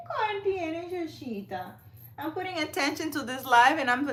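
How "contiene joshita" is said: high, sing-song pet talk. Real, not acted. Talking baby talk to her cat.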